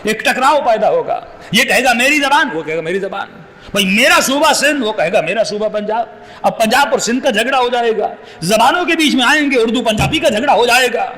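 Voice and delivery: normal talking voice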